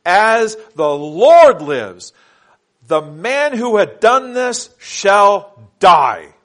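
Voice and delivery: loud, slow, forceful declaration; angry king's oath. Acted. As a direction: thunder the king's oath slowly. David's furious oath in scripture reading.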